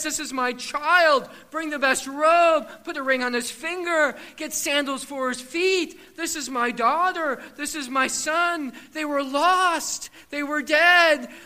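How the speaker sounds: loud, slow father calling out; a father shouting that his child is home; father's joyful call. Acted. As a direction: proclaim it like an overjoyed father. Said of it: "He stands up and says".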